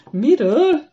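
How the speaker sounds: a hiccup popping up high and loud. A narrator's reading. Drunk hummingbird hiccups the question, high and loud. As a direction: hiccup the word out tipsily. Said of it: "csuklott a kolibri".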